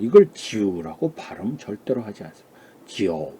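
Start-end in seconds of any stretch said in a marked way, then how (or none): 2.9-3.3 지오 lower, with a clear o vowel at the end; teacher showing the correct 지오; correct pronunciation demo. Shown as a demonstration. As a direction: model 지오 slowly, gliding into o. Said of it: "이렇게 발음하죠".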